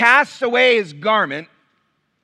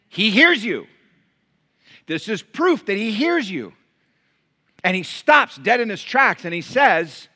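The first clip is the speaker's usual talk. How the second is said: normal talking voice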